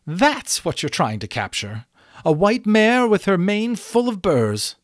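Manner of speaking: scornful, climbing high and loud; indignant. A narrator's reading. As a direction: build to an affronted peak. Insulted unicorn.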